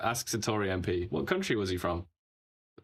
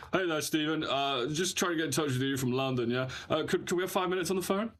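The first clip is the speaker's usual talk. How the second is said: lightly raised, breezy posh London caller; mock posh journalist; 7 semitones higher than usual. Host pitching a fake Financial Times call to an MP. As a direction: play a smooth reporter ringing from London. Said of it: "pretend that we're from the Financial Times".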